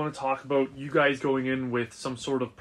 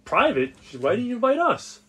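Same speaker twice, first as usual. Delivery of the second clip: excited teasing; 7 semitones higher, 4 dB louder than usual. Acted. Friend teased at dinner.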